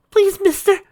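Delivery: put-on high, pleading girl voice; begging girl. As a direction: beg him, high and desperate. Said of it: "she begged".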